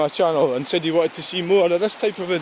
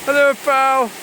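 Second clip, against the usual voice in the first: high, slow sing-song pet talk; cooing high at a wild bird on the river; 9 semitones higher, 5 dB louder, slower than usual. Real, not acted. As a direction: coo at the heron like a pet. Greeting the heron again.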